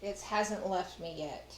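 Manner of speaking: normal talking voice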